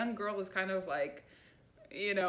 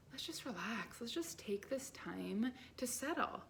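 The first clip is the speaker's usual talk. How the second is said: whispered, calm inner voice; soft whisper; 3 semitones higher, 7 dB quieter than usual. Retold or imitated. Her calm center whispering to herself to settle. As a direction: breathe it out gently, unvoiced.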